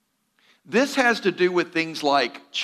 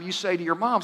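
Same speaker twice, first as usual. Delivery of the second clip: ordinary talk, nothing marked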